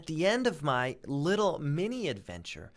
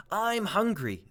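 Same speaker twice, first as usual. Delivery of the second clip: a hint of a hungry spider, raised and louder; lightly voiced spider; 4 semitones higher, 6 dB louder than usual. Spider announcing hunger to James, lightly voiced hungry spider. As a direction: announce your hunger suddenly. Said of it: "the spider announced suddenly".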